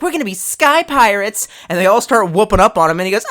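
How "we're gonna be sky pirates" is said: high, prissy mock Vaughn voice; effeminate accent. Mocking a game character's effeminate line, high and prissy. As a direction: mince it out in a silly effeminate lilt. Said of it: "horrible, like, effeminate accent".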